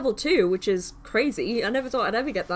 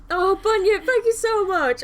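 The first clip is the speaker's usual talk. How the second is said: high, loud, thrilled thank-you; higher and louder overjoyed thanks to a viewer; excited thanks; 10 semitones higher, 7 dB louder than usual. Real, not acted. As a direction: gush with delight at the gift.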